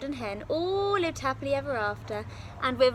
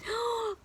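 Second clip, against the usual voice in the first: startled gasp; 11 semitones higher than usual. Acted. Giantess hears footsteps.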